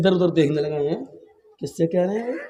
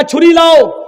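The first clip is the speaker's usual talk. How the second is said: higher, louder order for the knife; commanding shout; 9 semitones higher, 5 dB louder than usual. Retold or imitated. Umar ordering his servant to fetch the knife, louder. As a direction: demand the knife firmly. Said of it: "حضرت عمر نے کہا".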